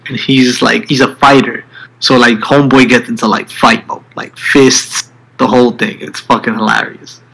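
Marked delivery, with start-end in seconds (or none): none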